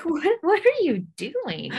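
high, slow, baffled question; baffled parent. Retold or imitated. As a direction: ask it in total disbelief. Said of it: "I'm like".

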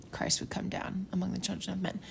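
whispered aside about Christ; hushed quote. Retold or imitated. Retelling Abinadi's words to listeners, whispered. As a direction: breathe the words almost unvoiced.

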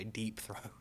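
hushed scandalous whisper. Pretend. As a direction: whisper the name like gossip. Whispered punchline about code name.

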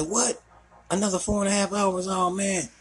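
high, far-off disbelieving groan; imitating the worn-out temp; mock dismay. Temp dismayed at hours left.

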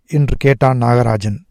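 ordinary delivery

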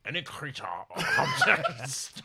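slow, laughing Scottish dragon; bad Connery as a dragon; Sean Connery dragon. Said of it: "That's the worst Sean Connery I've ever".

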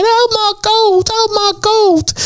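high, slow wailing mimicry of women sobbing; mock crying women. Doorman mimics crying women at a club shooting, high mock wail. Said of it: "all these birds around crying, going".

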